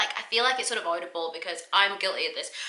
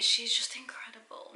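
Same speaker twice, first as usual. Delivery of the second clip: whispered awe; 2 semitones higher, 6 dB quieter than usual. Real, not acted. Host gushing about the US star, whispered. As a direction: breathe it in a hushed whisper.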